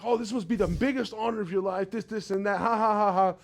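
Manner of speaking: high, singsong jeering with a fake laugh; mock them, ending in a put-on ha-ha-ha. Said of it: "they're over here mocking them".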